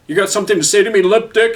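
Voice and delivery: high, loud menacing scream; screaming cop. A narrator's reading. Cop screams down at Frank, menacing scream. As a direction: tower over him and scream. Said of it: "he screamed at Frank".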